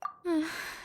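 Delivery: soft weary sigh; resigned sigh. Acted. Mona gives in on rent, resigned sigh. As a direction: let out a defeated breath.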